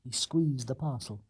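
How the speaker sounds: usual speaking voice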